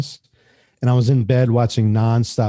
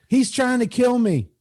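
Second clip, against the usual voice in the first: mock panic; 11 semitones higher than usual. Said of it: "What's he doing".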